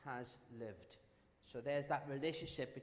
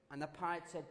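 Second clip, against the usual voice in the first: ordinary delivery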